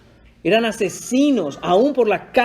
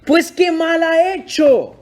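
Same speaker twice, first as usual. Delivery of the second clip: pleading Pilate; 9 semitones higher, slower than usual. Retold or imitated. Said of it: "Pilato les decía".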